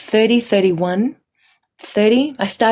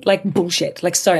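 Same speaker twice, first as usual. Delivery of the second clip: ordinary delivery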